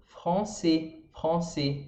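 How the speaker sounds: français with a throaty R and nasal an; French français. Shown as a demonstration. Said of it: "Por exemplo".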